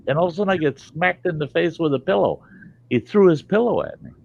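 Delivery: ordinary delivery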